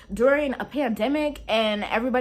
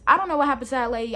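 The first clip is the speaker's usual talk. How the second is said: ordinary delivery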